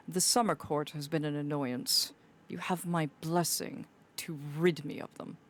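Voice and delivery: lightly voiced stately lord, dismissive; Lord Knight impression. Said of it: "my best impersonation of Lord Knight".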